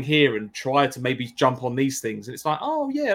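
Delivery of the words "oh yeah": sudden high oh of realization; light mock aha moment; surprised realization. Retold realization while playing.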